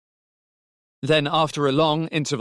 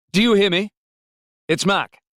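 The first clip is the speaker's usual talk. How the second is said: put-on raised, higher voice to reach the child; loud, higher-pitched hailing; calling down the phone; 9 semitones higher, 6 dB louder than usual. A narrator's reading. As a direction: call to check someone is listening. Calling child over telephone.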